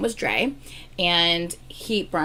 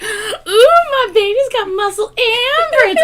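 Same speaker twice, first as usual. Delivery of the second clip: much higher, louder, slow swooning coo, laughing through it; gushing mock swoon; 8 dB louder, slower than usual. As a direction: gush over your boyfriend, hamming it up. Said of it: "I was like".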